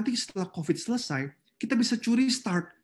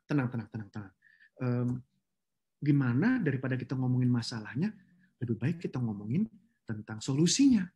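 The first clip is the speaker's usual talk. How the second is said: calming voice; 7 semitones lower than usual. Shown as a demonstration. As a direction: settle the room gently. Calming angry office.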